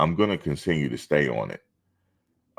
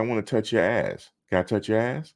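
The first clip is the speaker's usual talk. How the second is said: higher, teasing retort; sassy retort; 7 semitones higher than usual. Retold or imitated. Answering the lady who asked about his hair, higher and teasing. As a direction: throw her request right back at her. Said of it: "I said".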